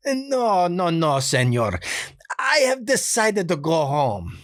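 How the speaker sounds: put-on high, slow pleading in a Spanish accent; a nice guy pleading in a Spanish accent. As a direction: beg off sweetly in a Spanish accent. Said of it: "that sort of pleading quality".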